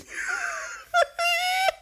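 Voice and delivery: helpless high-pitched laughter; high wheezing laugh. Real, not acted. Cracking up at the recruit locked in a locker, high wheezing laugh.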